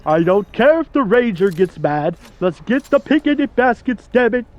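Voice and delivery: hammed-up Yogi impression; Yogi Bear. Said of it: "I can do the Yogi voice".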